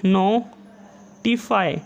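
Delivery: Notify drawn out very slowly, each syllable held; slowly stretched dictation. Shown as a demonstration. Teacher dictating notify to shorthand students, very slowly stretched.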